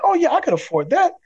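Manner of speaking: high-pitched, breezy mock enthusiasm; eager car buyer. Car buyer shown a monthly note, mock-eager. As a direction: play the easily convinced customer, pitch jumping up.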